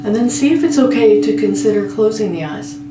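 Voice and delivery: slow, measured meditation voice with long pauses; guided meditation. Real, not acted. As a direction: guide a listener gently toward sleep, phrase by phrase. Leads a guided sleep meditation.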